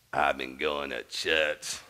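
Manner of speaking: put-on low, slow old Southern lady voice; imitating elderly churchwoman. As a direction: draw it out like an aged churchgoer. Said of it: "So Mother Brown looks at me and goes".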